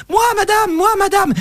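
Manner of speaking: put-on high, eager little boy's voice; high excited child answering the instructor. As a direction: pipe up like an eager kid. Child voice in joke.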